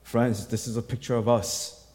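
normal talking voice

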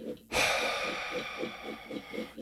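long, soft breathy sigh right into the mic; ASMR sigh. Pretend. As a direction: sigh slowly for the ASMR listeners. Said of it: "A little bit of A S M R for you".

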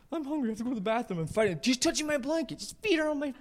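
put-on high-pitched whining child voices; squabbling kids. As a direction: imitate children complaining in the back seat. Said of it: "They start fighting".

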